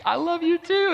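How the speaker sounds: very high, gushing squeal of words; light up, pitch leaping way up; imitating delighted girlfriend. Girlfriend answers his first confession, imitated in high excitement. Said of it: "she lit up like a Christmas tree".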